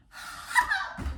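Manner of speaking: very high, short hop; bouncy jump call. Pretend. Teacher jumps like a frog on three, high bouncy hop. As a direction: pop the word up high.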